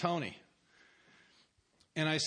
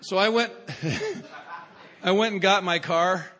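laughing; 4 semitones higher, 7 dB louder than usual. Real, not acted. Laughing while retelling.

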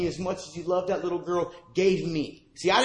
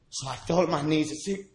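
usual speaking voice

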